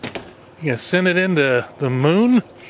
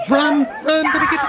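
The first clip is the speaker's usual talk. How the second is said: excited urging; 12 semitones higher than usual. Real, not acted. Urging child to run.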